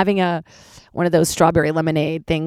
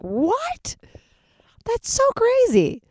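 high, slow, stretched-out amazement; amazed; much slower than usual. Retold or imitated. Hearing that the halibut was shipped to LA, high stretched amazement. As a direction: gasp it out like you can't believe it.

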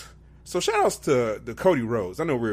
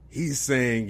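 ordinary delivery

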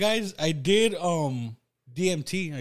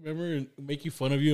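ordinary delivery

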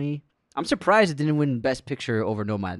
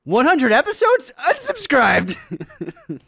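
high, loud yell with stretched catchphrase; mock outrage; 13 semitones higher, 7 dB louder, slower than usual. Host reading a hate comment, high shouted mock outrage. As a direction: shriek it in comic indignation.

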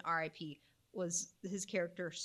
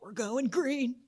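a hint of Dusty, higher and louder, awed; Dusty from Twister; 7 semitones higher, 6 dB louder, slower than usual. Quoting Dusty in Twister about the sky, mildly awed. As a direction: deliver it like the stunned storm chaser. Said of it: "Dusty comes up to him and he's like".

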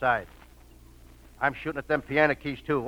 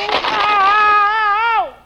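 very high, loud squeal of pain; pained yelp; 8 dB louder than usual. Acted. Arm twisted by the stranger, a loud high squeal.

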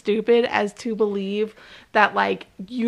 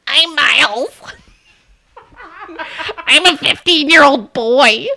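put-on dumb boy voice; 2 semitones higher, 3 dB louder than usual. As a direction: play him like a dim caveman kid. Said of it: "I'm giving Miles his deep voice".

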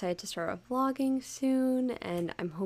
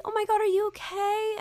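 high, concerned classmate voice; worried classmates; 13 semitones higher than usual. Retold or imitated. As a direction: ask with mock alarm. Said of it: "Like, I'd be like".

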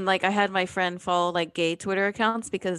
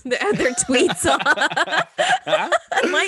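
cracking up mid-sentence, louder and higher; real laughter; 6 semitones higher, 5 dB louder, slower than usual. Real, not acted. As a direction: try to talk through a laughing fit. Cracks up mid-sentence at own slip.

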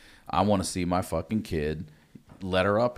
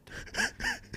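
high giggle; 7 dB quieter than usual. Retold or imitated. Laugh inside the impression.

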